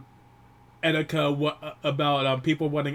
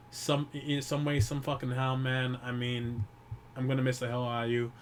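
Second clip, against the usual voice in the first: usual speaking voice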